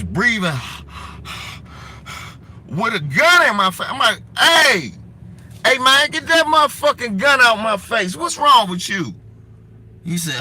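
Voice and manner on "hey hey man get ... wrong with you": high, loud retold outrage; indignant protest. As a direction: protest loudly at a gun pointed at you. Protesting at gun in his face.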